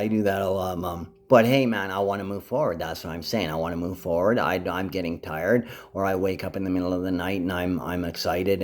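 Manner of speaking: ordinary talk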